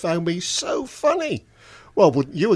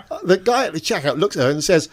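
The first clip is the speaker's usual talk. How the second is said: ordinary talk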